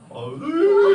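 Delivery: high hammed-up sob sounds; laughing while faking a cry; mock sobbing. Three boys hugging the hurt dog, mock sobbing. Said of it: "이러면서 셋이 울고".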